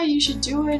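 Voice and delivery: ordinary delivery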